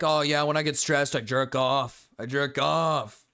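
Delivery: mock dopey voice, dragged out slowly. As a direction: sneer through a dumb quote. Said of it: "him saying".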